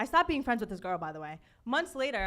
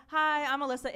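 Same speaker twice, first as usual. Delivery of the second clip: ordinary talk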